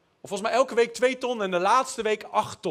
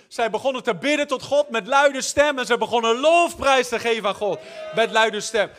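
raised, louder preaching voice; loud proclamation; 4 semitones higher, 6 dB louder than usual. Retold or imitated. Preacher telling how prisoners praised God, loud proclamation.

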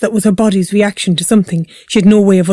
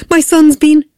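high, loud, cut-off plea; urgent, raised cry; frantic outburst; 8 semitones higher, 5 dB louder than usual. A narrator's reading. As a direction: blurt out the emergency in panic. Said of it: "she burst out".

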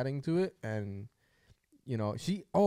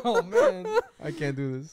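laughing; 6 semitones higher, 5 dB louder than usual. Real, not acted. Reacting to very hot sauce.

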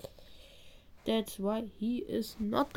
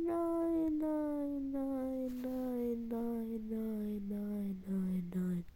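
fading despair; 7 dB quieter, much slower than usual. Real, not acted. Repeated despairing nos.

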